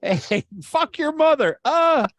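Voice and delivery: high, loud, slow heckler shout; mock heckler. Comic mocks a heckler shouting down the punchline. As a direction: yell it like a drunk heckler. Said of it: "like a heckler during a punchline".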